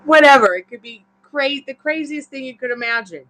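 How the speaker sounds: high and loud, gushing over wild possibilities; excited burst. Real, not acted. Reader urging the client to dream wildly, excited and loud. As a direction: throw it out there with big excitement.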